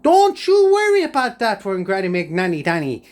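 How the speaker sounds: loud high squeal of reassurance; a high, loud boast; mock reassurance. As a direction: shout it up high, cocky. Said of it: "I went".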